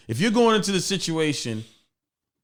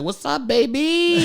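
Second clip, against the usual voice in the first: sleazy come-on; 11 semitones higher, 4 dB louder, slower than usual. Retold or imitated. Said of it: "He's like".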